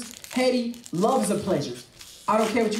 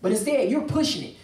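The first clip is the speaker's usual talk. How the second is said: ordinary talk, nothing marked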